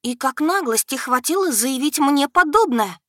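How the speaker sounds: loud, high outraged reproach; indignant scolding. Acted. Heroine scolds the brazen dragon, loud and outraged. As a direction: scold him for his cheek.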